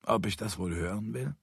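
ordinary delivery, nothing marked

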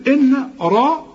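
"إن را" with a heavy ra and a thin "إن"; heavy ra only. Shown as a demonstration. Teacher showing the student a heavy ra beside light letters. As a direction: make only the ra heavy in "إن را", keep the rest light. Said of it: "فخم لي بس الراء".

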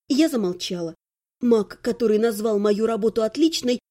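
ordinary delivery, nothing marked

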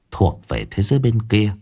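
ordinary delivery, nothing marked

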